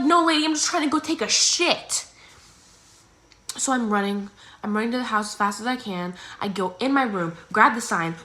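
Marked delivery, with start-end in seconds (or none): 0.0-2.1 exasperated. Retold or imitated. Exasperated reply.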